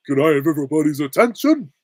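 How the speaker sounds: Patrick. Acted. Cartoon starfish calling the bar to attention, loud impression. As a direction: call it out as a cartoon dimwit. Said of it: "best bits of Patrick".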